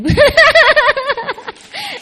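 a loud, high burst of laughter. Real, not acted. Nun laughing loudly at her own point to the listeners. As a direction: let out a big high laugh.

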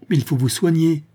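Véronique cries out to the wounded servant; urgent cry. A narrator's reading. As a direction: cry out in alarm for her. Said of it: "s'écria Véronique".

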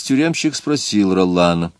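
usual speaking voice

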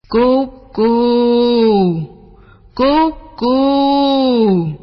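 loud, hooting bird cry; cuckoo call. A narrator's reading. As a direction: coo out long like a cuckoo. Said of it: "một con chim cu".

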